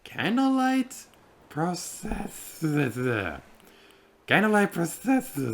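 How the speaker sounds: stumbling over process-; struggling pronunciation. Shown as a demonstration. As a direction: trips on process- each time. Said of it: "why can I not say the other word".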